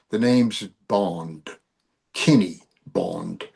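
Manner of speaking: low, slow, lightly British Bond delivery; mock British accent. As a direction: put on a suave British accent, slowly. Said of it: "in your best British accent".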